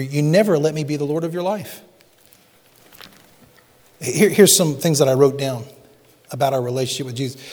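ordinary talk, nothing marked

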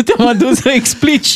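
high-pitched, through laughter; laughing protest, pitched high. Real, not acted. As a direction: tease him while laughing. Co-host teasing amid laughter.

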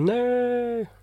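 high whiny little no; sulky child. Retold or imitated. Daughter refusing her dad, high pouting. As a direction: pout out a child's no. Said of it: "Og hun bare".

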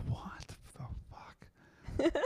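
long, drawn-out disbelieving whisper; hushed mock astonishment, stretched out; stunned whisper. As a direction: whisper it slowly, as if dumbfounded. Retold stunned reaction to video.

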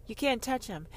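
ordinary delivery

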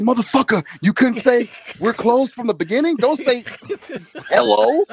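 high, indignant complaining; exasperated rant. Retold or imitated. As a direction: rant like you were cheated. Retold rant at Burger King.

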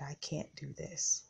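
fading to a near-whisper, holding back tears; softly breaking, missing her husband; choked-up whisper. Retold or imitated. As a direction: barely get it out, eyes welling. Grieving widow near tears.

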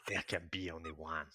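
low, quiet movie-trailer growl of the line; Highlander impression. [name] redoes the movie line for Chris, low and quiet. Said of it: "That's it. That's better".

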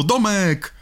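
high, drawn-out name call; mock calling out. Shown as a demonstration. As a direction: stretch the last syllable up high. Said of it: "to by było już lepiej".

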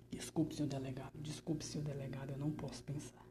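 hushed, fast apologetic whisper; frightened whisper. Acted. Prisoner caught thinking whispers an apology to the police chief.

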